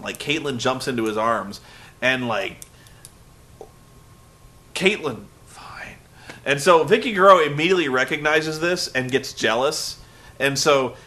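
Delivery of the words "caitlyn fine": soft voiceless whisper; leering whisper. Real, not acted. Vlogger ogling Caitlyn, a hushed whisper. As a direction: mutter it like a naughty aside.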